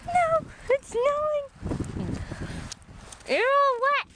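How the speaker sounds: high, slow cooing to the dog; high-pitched pet talk to a snowy dog. Real, not acted. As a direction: coo at the wet pup in a high voice. Talking to the wet dog.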